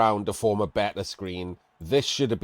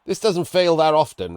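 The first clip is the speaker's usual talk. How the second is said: louder, higher insistence; emphatic; 7 semitones higher, 6 dB louder than usual. Real, not acted. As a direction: drive the point home. Emphatic point to chat.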